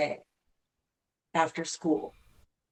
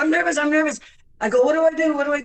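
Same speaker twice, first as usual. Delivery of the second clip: put-on nervous child; 9 semitones higher, 5 dB louder, much faster than usual. Child panicking before concert.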